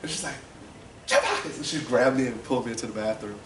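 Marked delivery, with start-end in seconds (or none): 1.0-1.6 high, loud imitation of a mother's outcry; scandalized mom. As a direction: call the full name way up high. Mother calls out in outrage.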